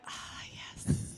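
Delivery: breathless, whispered relief; nervous whisper. Retold or imitated. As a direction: whisper it, shaky with nerves. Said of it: "super nervous and shaking".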